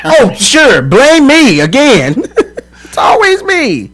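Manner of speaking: high, drawn-out sarcastic protest; slow, high-pitched aggrieved whine; mock indignation. Real, not acted. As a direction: wail it slowly as the wronged party. Mock protest at being blamed.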